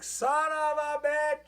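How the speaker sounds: high, slow drawn-out mock outburst; shocked curse. Retelling finding ants, drawn-out high shocked curse. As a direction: squeal it like you just spotted the ants. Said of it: "and I'm like".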